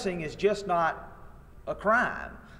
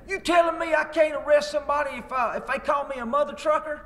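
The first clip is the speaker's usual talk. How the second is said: put-on indignant Southern accent; 10 semitones higher, 3 dB louder than usual. Large Southern man at the seminar challenging the teacher, mock-outraged Southern accent. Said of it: "in this particular accent".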